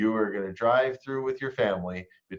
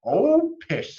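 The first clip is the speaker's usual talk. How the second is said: very high, loud scoffing; dismissive mother; 13 semitones higher, 7 dB louder than usual. Acted. Mother waving away her daughter's worry, high and scoffing. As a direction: brush it off like a fond mother. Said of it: "said her mother".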